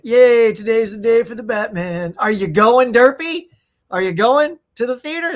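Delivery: loud, bright cheer to a fellow creator; excited greeting. Real, not acted. Calling out to Derpy Gaming about Batman, loud and excited.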